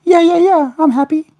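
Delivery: very high squeaky falsetto; pet bird's answer. Pretend. Owner answering for the happy bird, squeaky falsetto.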